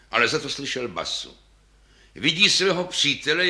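ordinary delivery

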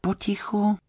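anxious high whisper; worried. A narrator's reading. Wife listening to the silent chest, worried high whisper. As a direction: whisper it with worry. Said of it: "strachovala se paní Fousková".

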